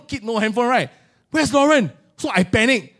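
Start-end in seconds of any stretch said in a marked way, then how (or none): 1.3-1.9 put-on raised, anxious call for a lost child; panicked call. Father searching for his lost daughter, calling out in panic. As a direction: call out her name in rising panic.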